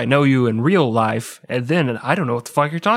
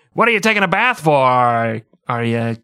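sitcom dad; 2 semitones higher, 4 dB louder than usual. Retold or imitated. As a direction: fire off the question like a sitcom father playing to the crowd. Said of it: "And then the dad's like".

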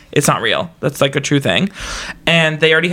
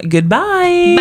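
sing-song goodbye; 13 semitones higher than usual. Real, not acted. Podcast sign-off farewell.

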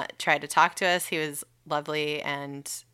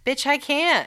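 high, louder, fed up "Bitch, I can't"; exasperated; 10 semitones higher, 5 dB louder than usual. Retold or imitated. Mid-contraction, brushing off the insurance caller, exasperated. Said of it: "and I'm like".

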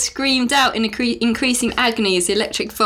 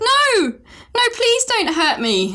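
mock sobbing plea; 13 semitones higher, slower than usual. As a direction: scream no, then beg slowly as if sobbing. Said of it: "Nicole sobbed".

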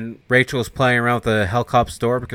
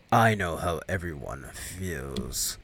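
much lower, softer, very slow; ominous devil; 6 semitones lower, 7 dB quieter, much slower than usual. Retold or imitated. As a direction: drag it out like doom. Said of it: "he's like".

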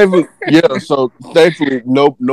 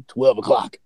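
usual speaking voice

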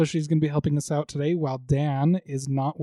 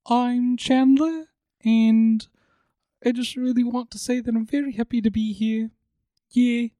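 sweet, earnest troll, pitched up; 6 semitones higher than usual. Acted. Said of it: "the troll voice".

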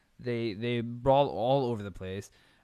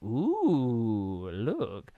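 mock awe; 3 dB louder than usual. Mock reaction to the censoring.

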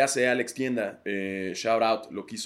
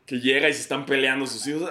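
ordinary talk, nothing marked

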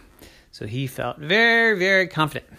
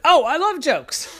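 high, eager exclamation; delighted; 13 semitones higher, 4 dB louder than usual. Acted. Shark hears koala has a joke, high delight.

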